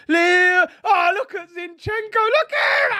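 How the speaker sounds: high, excited shouting at live play; high-pitched fan's yell. Real, not acted. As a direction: cry out in rising amazement.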